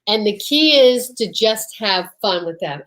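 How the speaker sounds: ordinary delivery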